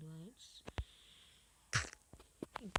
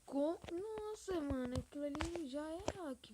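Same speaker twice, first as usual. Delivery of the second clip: outraged; 8 semitones higher, 5 dB louder than usual. Real, not acted. Accusing a hacker mid-game.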